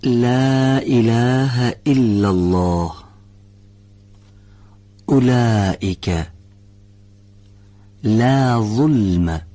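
slow, drawn-out tajwid recitation; Quran recitation. Shown as a demonstration. Teacher reciting examples for the class slowly. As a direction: recite slowly with careful lām. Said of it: "به این کلمات و عبارات دقت بفرمایید".

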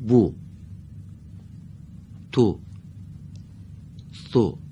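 "بو، تو، سو" as separate short u syllables; brief Arabic u after each consonant; Arabic short damma. Shown as a demonstration. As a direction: model "بو، تو، سو" one by one with Arabic vowels. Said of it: "اینها با ضمهٔ کوتاه خونده شد".

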